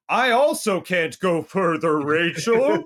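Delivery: slow spooky Transylvanian drawl on the last words; Transylvanian accent. Retold or imitated. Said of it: "but her not".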